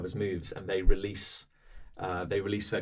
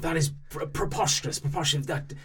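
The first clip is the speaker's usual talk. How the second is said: high, sputtering indignant protest; flustered denial; 5 semitones higher, 3 dB louder than usual. Acted. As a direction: splutter like a cornered official. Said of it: "he's like".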